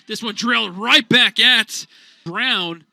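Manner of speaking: sudden jolt of alarm; alarmed call. Real, not acted. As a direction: react as the ball rockets at the pitcher. Comebacker at the pitcher.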